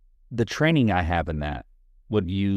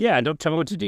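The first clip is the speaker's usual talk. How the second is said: higher, defiant mock protest; pitched-up playful defiance; 10 semitones higher, 3 dB louder than usual. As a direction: play the indignant rebel.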